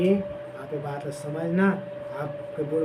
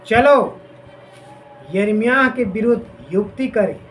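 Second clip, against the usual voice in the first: loud, slowed dramatic reading of the plotters; scripture reading; 2 semitones higher, 3 dB louder than usual. Acted. Preacher reads Jeremiah's enemies plotting, loud and dramatic. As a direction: read the conspirators' words with force.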